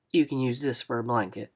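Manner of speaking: a hint of a gentle older driver; lightly voiced older man. Narrator voices Mister Lewis.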